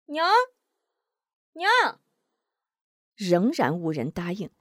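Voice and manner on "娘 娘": high calling shout. A narrator's reading. Wu Zhong shouting for his mother outside, high shout. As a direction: call out for mom at the door. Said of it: "转身出门高喊".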